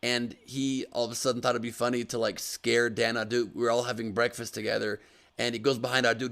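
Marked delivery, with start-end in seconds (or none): none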